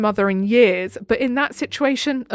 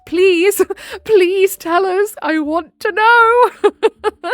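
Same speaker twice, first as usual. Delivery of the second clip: pleading; 10 semitones higher, 5 dB louder, much slower than usual. Pretend. Playful begging for the story.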